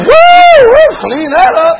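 high, loud whoops of delight; whooping joy. Retold or imitated. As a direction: whoop it up like the place is a miracle. Naked in spotless house, whooping.

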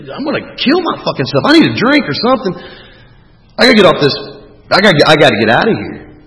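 high, urgent and frantic; worked-up panicky plea; desperate outburst. Retold or imitated. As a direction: burst out like you have to escape now. Reenacts desperate outburst to partner.

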